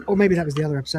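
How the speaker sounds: normal talking voice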